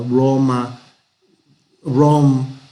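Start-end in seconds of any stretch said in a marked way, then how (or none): 1.8-2.6 "rom" with a soft, untrilled English r, louder. Shown as a demonstration. Teacher demonstrating English R to students, soft r in "rom". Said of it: "La R inglesa es suave".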